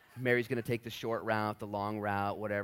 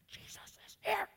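high sarcastic whisper; mock hiss. Martha whispering back to her giddy sister.

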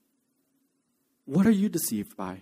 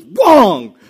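a high, very loud bark of wrong; furious shout; 10 semitones higher, 14 dB louder than usual. Retold or imitated. Hopkins rejecting the written answer, shouting furiously. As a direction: yell it down as you tear the paper away.

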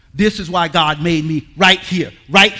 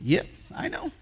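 mild weary friend; 3 semitones higher, 10 dB quieter than usual. Retold or imitated. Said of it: "he's just going".

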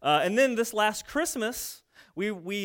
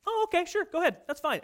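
much higher, breezy and agreeable; peaceful patient waiter; 9 semitones higher, faster than usual. Pretend. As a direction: accept the delay sweetly. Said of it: "waiting peacefully".

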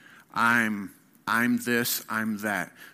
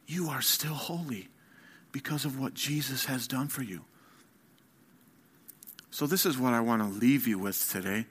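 ordinary talk

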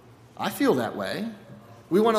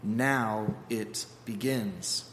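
low, very slow, soft; comforting God; 6 semitones lower, 4 dB quieter, slower than usual. Acted. God comforts Moses, low and very slow. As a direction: ease his worry tenderly. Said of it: "It's like".

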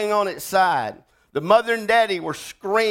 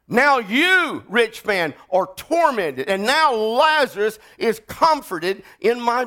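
fiery preacher calling for a witness; preaching at full force; 7 semitones higher than usual. Acted. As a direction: bring the congregation to its feet. Preaching rich man's fate.

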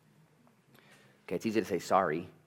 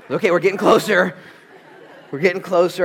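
much higher and louder, laughing through it; laughing encouragement; 11 semitones higher, 14 dB louder than usual. Retold or imitated. Dad coaxing his son's apology, laughing and loud. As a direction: cheer him on while cracking up.

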